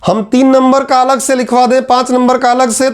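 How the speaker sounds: ordinary talk, nothing marked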